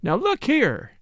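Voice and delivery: put-on mildly impatient, resolute girl; sudden decision. A narrator's reading. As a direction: burst out as if your mind's made up. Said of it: "she burst out with sudden decision".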